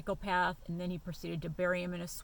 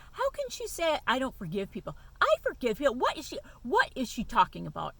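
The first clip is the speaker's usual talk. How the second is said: higher, louder indignant protest; indignant outburst; 6 semitones higher, 6 dB louder than usual. Retold or imitated. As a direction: fume at the accusation, voice rising. Fuming retold indignation.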